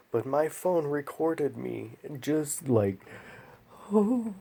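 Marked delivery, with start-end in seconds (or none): 3.9-4.4 put-on whimpering child. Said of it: "I sound like a whimpering child".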